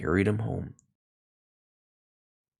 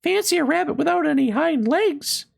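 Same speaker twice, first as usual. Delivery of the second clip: mocking; 3 dB louder, faster than usual. A narrator's reading. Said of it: "And he began to laugh".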